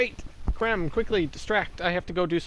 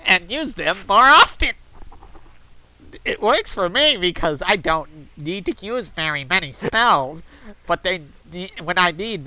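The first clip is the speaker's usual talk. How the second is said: pinched, nasal, with the nostrils held shut; nose-plugged voice. Acted. Reading Mister Happy's post aloud while holding his nose. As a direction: read it holding your nose like a smug poster. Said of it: "I should be plugging my nose".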